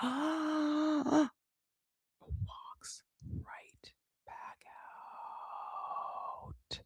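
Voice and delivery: gasp, then a very slow whisper; shocked whisper. Shown as a demonstration. Host repeating his walk-out to listeners, horrified slow whisper. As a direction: whisper it drawn out for dramatic shock.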